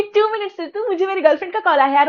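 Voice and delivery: high, fast casual brush-off; fast high offhand dismissal; quick brush-off. Retold or imitated. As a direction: rattle it off and dismiss her. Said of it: "उसने मुझे बोला कि".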